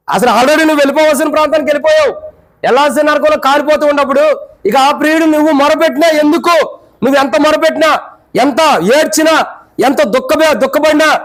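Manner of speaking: pleading sermon at full force; fervent preaching. Acted. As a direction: preach it out with urgency.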